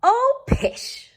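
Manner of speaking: very high, loud scoffing; dismissive mother. A narrator's reading. Mother dismissing the teasing, scoffing. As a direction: brush the worry away. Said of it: "said her mother".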